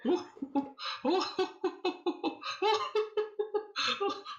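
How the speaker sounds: high, hammed-up cackling laugh; villain laughter. GM voices host laughing.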